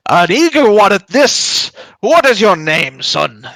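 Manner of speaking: high, loud, drawn-out hail; dwarf announcer. Acted. Dwarf greets a newcomer to the arena, booming dwarf voice. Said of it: "it's the dwarf back here".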